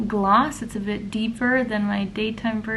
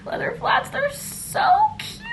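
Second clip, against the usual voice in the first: gushing cute. Real, not acted. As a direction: squeal at the shoes. Gushing over new flats.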